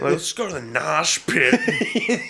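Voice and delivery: high, slow, laughing mock voice; high goofy voice. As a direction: stretch it out, high and silly. Quoting singer, high laughing voice.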